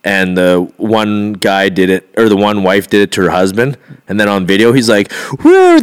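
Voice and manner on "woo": high, loud mock whoop; imitated cheer of joy; celebratory whoop. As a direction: whoop like a lottery winner. Lottery winner celebrates on video.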